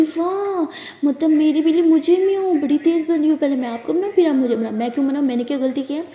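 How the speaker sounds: loud, indignant sarcasm; sarcastic anger. Acted. Angry girlfriend sarcastically scolding her partner, loud. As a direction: throw the blame back, loudly and mockingly.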